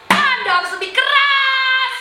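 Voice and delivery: high, ringing exhortation; rally them, holding out the last word; rousing cry. Acted. Performer urging audience to out-tough an opponent, rousing cry.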